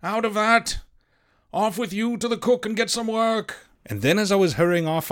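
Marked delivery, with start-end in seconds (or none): 0.0-3.7 captain crying out. A narrator's reading. Captain shouts at the boy by the swivel, barked call. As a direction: call the boy away sharply.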